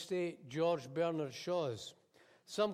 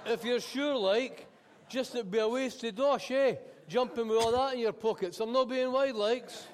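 higher, louder wheedling patter; loud cheeky coaxing; 6 semitones higher, 7 dB louder than usual. Acted. Cabbie sweet-talking the passenger out of her cash, loud. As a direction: talk her out of her money with a grin. Cabbie coaxes the fare money.

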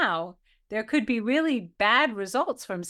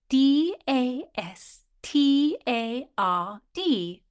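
slow spelling out; 4 semitones higher, much slower than usual. Shown as a demonstration. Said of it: "Can you give us the spelling".

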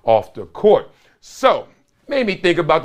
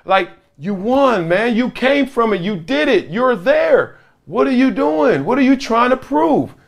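high, building retold pep talk; insistent exhortation; 7 semitones higher than usual. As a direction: imitate a counselor pleading for sense, pitch climbing. Acted counselor urging him, building.

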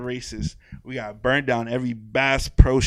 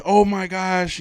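excited greeting; 8 semitones higher, 7 dB louder, much slower than usual. Retold or imitated. Retold greeting of long-lost friend.

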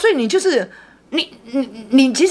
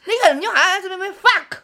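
higher, laughing as she retells the cursing; laughing while retelling; 9 semitones higher than usual. Real, not acted. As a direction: giggle through the memory of swearing at a ghost. 笑着回忆骂鬼. (笑着讲骂鬼的事)

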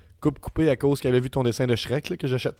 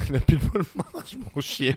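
slow, teasing parody of his friend's excuse; mocking repeat; 2 semitones higher, slower than usual. Co-host teasingly parroting his friend's excuse: mock imitation. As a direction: echo the excuse back like it's ridiculous.

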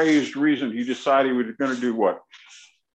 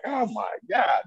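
shocked exclamation; 7 semitones higher than usual. Retold or imitated.

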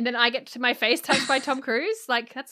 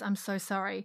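much softer, tender sorry; gentle apology; 2 semitones lower, 7 dB quieter than usual. Retold or imitated. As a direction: soothe her quietly, like a kind hero. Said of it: "her face really gently and says".

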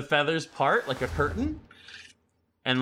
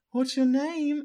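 high owl voice asking; high-pitched creature voice; great owl; 3 dB louder, slower than usual. Acted. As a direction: ask it up high as a kindly owl keeper. Owl asks the girl's name.

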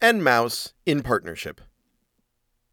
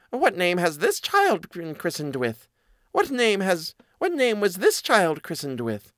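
high mouse voice; inquiring mouse; 6 semitones higher than usual. Acted. Mouse asking the christened child's name, high and curious.

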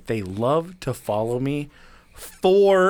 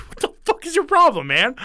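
much higher, louder exasperated whine; flustered kid whining at the cop, much higher; upset squeal; 12 semitones higher, 4 dB louder than usual. Retold or imitated. Upset teen snaps at cop.

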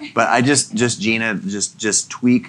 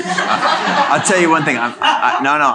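laughing; 8 semitones higher, 5 dB louder than usual. Real, not acted. Host bursts out laughing, impressed.

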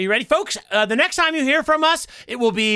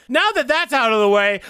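usual speaking voice

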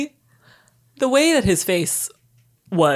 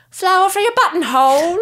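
put-on much higher, louder flower-girl cry; high 1930s lady; 10 semitones higher, 4 dB louder than usual. Flower girl calling out to passersby, high-pitched and chirpy. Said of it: "In that extremely high-pitched nineteen thirties lady voice".

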